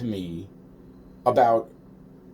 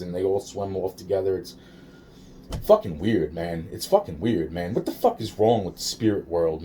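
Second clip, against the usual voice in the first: ordinary delivery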